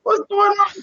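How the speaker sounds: high, startled mock outrage; mock shocked. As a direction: squeal it like you just got caught. Dog took weed to mama.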